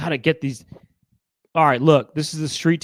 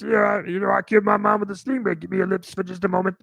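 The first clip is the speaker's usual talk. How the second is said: [name] voice; 5 semitones higher than usual. Retold or imitated. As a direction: croon it like [name]. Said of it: "sounds a little bit like this".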